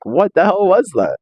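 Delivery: mock disgusted shock; baffled outburst. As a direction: recoil from a bad movie. Audience reacts to the movie.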